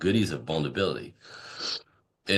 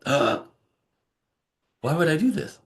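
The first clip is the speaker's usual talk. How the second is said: higher, startled question from the client; incredulous client; 10 semitones higher, 3 dB louder than usual. Retold or imitated. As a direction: balk at the idea, pitched up high. Client pushing back on advice.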